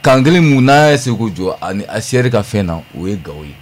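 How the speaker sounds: normal talking voice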